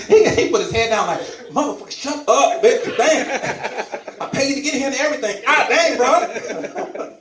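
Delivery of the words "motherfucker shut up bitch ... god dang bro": high, loud mock rant, tumbling fast; hammed-up furious outburst; mock angry cussing. A man yelling at the person who woke him, in hammed-up fury.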